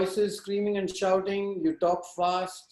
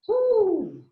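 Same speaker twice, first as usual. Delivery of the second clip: excited woo; 13 semitones higher, 4 dB louder than usual. Shown as a demonstration. Acting out wall building.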